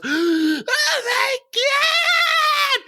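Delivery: high, drawn-out mock scream; long, high-pitched horrified squeal; screaming girl. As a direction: shriek it like a shocked girl. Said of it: "She starts screaming".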